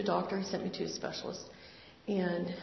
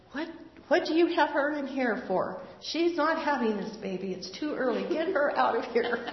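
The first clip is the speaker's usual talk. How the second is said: higher and louder, a retold indignant outburst; imitating an outraged doctor's higher, louder demand; 6 semitones higher, 4 dB louder than usual. Tall doctor bursting into room.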